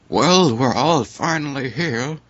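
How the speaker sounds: high, slow character voice for the friend; put-on friend voice. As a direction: voice the mystery friend, high and drawn out. Reading friend's line in fanfic.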